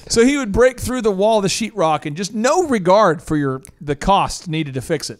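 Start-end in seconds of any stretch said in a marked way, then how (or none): none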